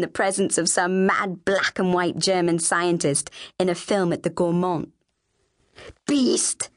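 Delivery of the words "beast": high, acted cry of outrage; angry insult. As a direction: hurl it at your sister. Sister hurls insult before storming out.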